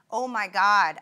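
loud narrated outcry, a little drawn down; crying out. A narrator's reading. Patient at the nurse's call button, crying out. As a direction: cry it out with a sudden push of volume. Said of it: "I cry".

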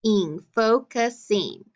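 "Ing" alone, then "focusing" slowly by syllable; sounded out. Shown as a demonstration. Teacher adding a suffix for pupils, sounded out. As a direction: stretch "focusing" into fo, cus, ing. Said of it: "add the I N G".

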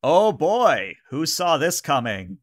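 sarcastic, irritated outburst; most annoyed voice. Acted. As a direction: exclaim it as a thoroughly annoyed crew member. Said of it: "in her most annoyed voice".

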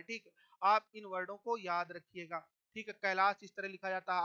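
ordinary talk, nothing marked

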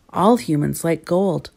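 ordinary delivery

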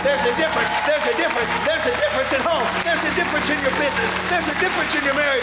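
fast, driving preacher's build; fiery preaching. Acted. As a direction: hammer the refrain home. Preaching at full force.

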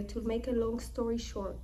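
normal talking voice

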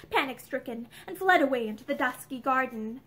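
ordinary delivery

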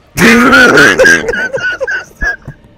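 high, shouted crying laugh; shrieking laugh. Real, not acted. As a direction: burst into a wailing laugh. Laughing after failing boss.

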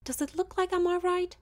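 loud, high furious retort; hissed. Acted. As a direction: throw it back at him in fury. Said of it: "she hissed".

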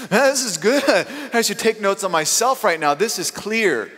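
high, delighted marvelling at your own voice; thrilled as the words pour out; giddy self-amazement. Pretend. Preacher imagines suddenly speaking perfect Spanish, high and delighted. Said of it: "And it just keeps".

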